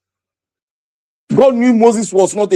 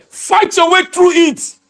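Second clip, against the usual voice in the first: higher, slower rallying cry; high drawn-out exhortation; 6 semitones higher, slower than usual. Acted. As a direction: stretch it out to stir the crowd.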